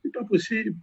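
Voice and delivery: ordinary talk, nothing marked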